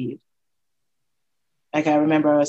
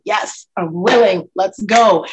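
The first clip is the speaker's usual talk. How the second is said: eager yes; 3 semitones higher, 7 dB louder than usual. Retold or imitated. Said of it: "is like ready to say".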